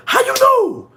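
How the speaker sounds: sudden high squeal of disbelief; how do you know, squealed high; shocked squeal. Real, not acted. Shocked reading viewer comment.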